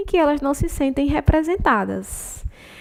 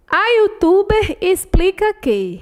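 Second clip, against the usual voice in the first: higher, louder, slow stress on "que"; slow emphatic reading; 6 semitones higher, 4 dB louder, slower than usual. Shown as a demonstration. Teacher reading the sentence slowly to test the word que. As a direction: read "A youtuber explica que" slowly, leaning on "que". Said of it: "Eu posso substituir esse que".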